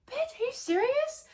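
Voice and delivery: very high-pitched disbelief, rising at the end; incredulous squeal. Real, not acted. Reacting to the mom's insult about Annie's Russian, high incredulous squeal. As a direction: shoot way up high on the question.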